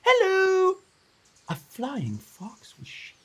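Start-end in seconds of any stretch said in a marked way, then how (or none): 0.0-0.8 high, loud cheery greeting; bright big hello. Acted. Flying fox greets the startled Ai Ai, high and loud. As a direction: swoop in with a big friendly hello. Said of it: "The monster stopped and said".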